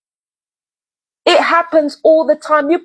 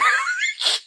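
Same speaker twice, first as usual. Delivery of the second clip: sudden high giggle, quieter; a short rueful laugh breaking the rant; laughing at herself mid-rant; high burst of laughter; 7 dB quieter than usual. Real, not acted. Laughs at own anger.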